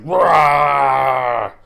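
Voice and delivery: loud, drawn-out imitated beast snarl; mock animal attack roar. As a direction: roar like a small animal lunging to bite. Acts out pet fox attacking face.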